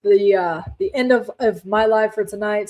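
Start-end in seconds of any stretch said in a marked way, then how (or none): 0.0-1.6 real halting stumble with repeats and fillers; hesitant false starts, searching for the words; stumbling over words. Real, not acted. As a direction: trip over the words, restarting as you go. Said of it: "I'm stuttering over my words".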